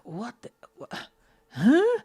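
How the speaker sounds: confused stammer rising to a high, loud huh; baffled huh. Retold or imitated. Confused by the shop girl asking about a bag, baffled huh. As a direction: stumble then yelp huh in confusion. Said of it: "I said".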